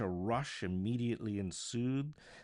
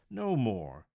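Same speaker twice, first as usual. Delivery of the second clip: loud, pleading no more; anguished cry; 2 semitones higher, 8 dB louder than usual. Acted. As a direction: cry it out, begging the ghost to stop. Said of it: "said Scrooge".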